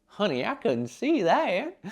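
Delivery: put-on high, slow old grandma. Older viewer missing a fast reel, slow high grandma voice. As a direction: play a sweet grandma who missed it. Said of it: "whatever my grandma would say".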